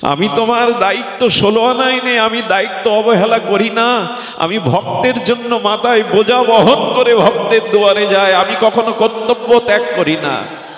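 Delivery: radiant, high-pitched divine proclamation; impassioned vow in a sustained high voice; Gopinath. Acted. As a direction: declare it high and ringing, with total conviction. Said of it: "দীপ্ত কণ্ঠে গোপীনাথ বললেন".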